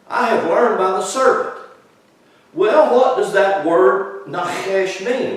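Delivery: full-voiced pulpit preaching, pitched high and loud; preaching at full force. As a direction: lift the whole room, preach it out.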